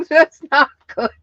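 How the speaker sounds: high, loud words through real laughter; laughing. Real, not acted. Seller reacting to Southern accents that are not good, laughing. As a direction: crack up while saying it. Cracking up over some accents.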